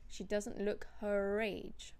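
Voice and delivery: slow demonstration with R linking "her age"; careful "her-rage" link in "her age". Shown as a demonstration. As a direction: pronounce the R of "her" straight into "age". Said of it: "you do pronounce the R".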